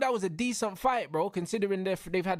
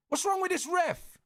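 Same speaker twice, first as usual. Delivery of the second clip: high, exasperated yell at the ref; real outrage at the ref; shouting at the ref; 7 semitones higher than usual. Real, not acted. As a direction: shout your disbelief at the referee. Yelling at the referee on stream.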